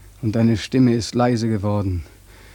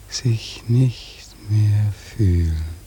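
very slow numb whisper; slow, toneless, unvoiced whisper; 2 semitones lower, much slower than usual. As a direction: let the words drift away, barely there. Dreaming of dying, numb.

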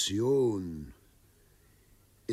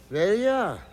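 calling out; 10 semitones higher, 6 dB louder than usual. Acted. Governor calls son from doorway.

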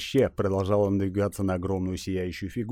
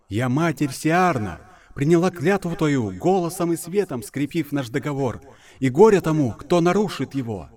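booming goddess voice; 7 semitones higher, 4 dB louder than usual. Acted.

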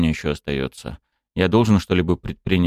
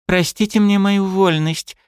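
slow, high, bowed apology; humble apology; 12 semitones higher than usual. A narrator's reading. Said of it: "склонив голову, произнесла она".

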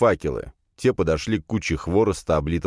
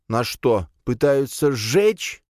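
higher, drawn-out frightened question; whimpering alarm; 7 semitones higher, slower than usual. A narrator's reading. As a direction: ask it in rising dread. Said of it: "всхлипнул Санёк". (испуганный протяжный вопрос, голос выше)

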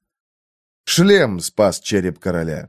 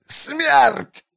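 high screamed last cry; high dying scream. A narrator's reading. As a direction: scream it out in agony. Dying man, repeated cry.

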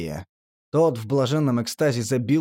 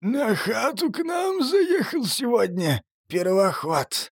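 slow prison boss voice; 10 semitones higher, slower than usual. Acted. Boss answers creature.

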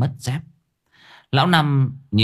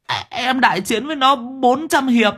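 very high, whining confession; tearful whimper; 13 semitones higher than usual. A narrator's reading. Sáu Bưởi explaining last night to boss, tearful. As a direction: blubber through a sheepish account. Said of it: "Sáu Bưởi mếu máo".

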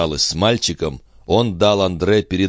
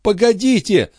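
very high urgent command; mother stopping Gilbert, very high and urgent; urgent stop; 3 dB louder than usual. Acted. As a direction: hold him back sharply. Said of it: "останавливая его за руку".

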